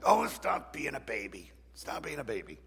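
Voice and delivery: mock scolding in an altered voice; self-scolding. People correcting themselves when they feel sad, mock scolding. Acts out scolding oneself for crying.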